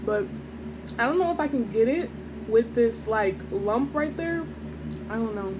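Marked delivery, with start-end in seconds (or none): none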